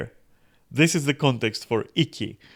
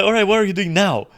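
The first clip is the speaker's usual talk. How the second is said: mock eager, higher, louder and fast; eager question; 7 semitones higher, 7 dB louder, faster than usual. Clingy fan pestering the streamer in DMs, mock eager. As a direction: rush it out like an overexcited fan. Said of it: "And this is like".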